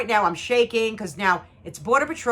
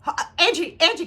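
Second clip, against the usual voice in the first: higher, anxious calling of a name; retold nervousness; 6 semitones higher than usual. Mother retells calling her daughter, high nervous voice. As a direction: re-enact nervously checking on someone. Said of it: "I was so nervous".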